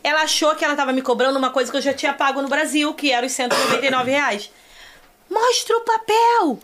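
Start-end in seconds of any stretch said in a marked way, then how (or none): 5.3-6.6 high, slow mock demand; hammered demand, pitched up and drawn out; demanding friend. Samira confronting the attendants, high slow demand. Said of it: "fala assim".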